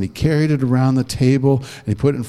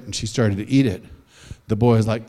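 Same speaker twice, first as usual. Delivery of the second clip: ordinary delivery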